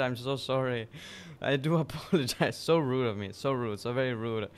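normal talking voice

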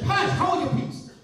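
loud, stern rebuke. Acted. Jesus rebuking the unclean spirit in a loud, stern voice. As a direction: shut the spirit up with command. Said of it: "rebuked him".

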